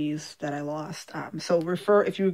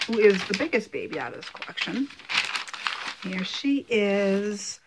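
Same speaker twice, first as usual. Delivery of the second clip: usual speaking voice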